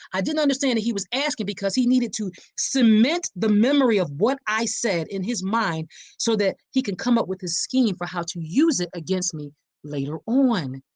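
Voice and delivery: ordinary delivery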